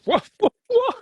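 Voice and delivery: very high, stunned repeated whats; mock shock. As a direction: squeal it in mock disbelief. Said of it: "I'm like".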